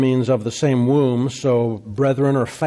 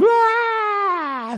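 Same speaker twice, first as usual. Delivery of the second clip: high, drawn-out panicked "aah"; mock freak-out scream. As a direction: shriek as if suddenly freaking out, played up. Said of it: "Something happens and all of a sudden".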